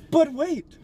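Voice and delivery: high, theatrical mock suspense; hammed-up show host. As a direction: pitch it up like a game-show tease. Hammed-up lead-in to show segment.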